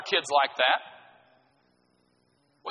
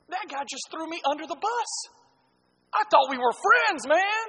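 put-on betrayed kid; 12 semitones higher than usual.

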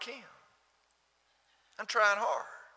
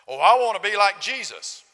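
mock pious eagerness; 5 semitones higher, 10 dB louder than usual. Mocking an eager believer.

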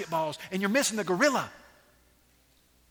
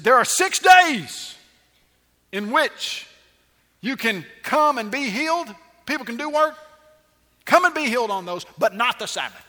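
pompous official; 5 semitones higher, 10 dB louder than usual. Retold or imitated. Synagogue ruler lecturing the crowd in a loud pompous voice. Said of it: "got his real pompous official look to him and said".

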